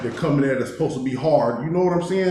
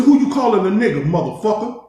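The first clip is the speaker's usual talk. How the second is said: higher-pitched re-enacted confrontation; retold challenge; 7 semitones higher, 3 dB louder than usual. Inmate calling out a slur in his retelling, indignant challenge. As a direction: throw the question back as a challenge.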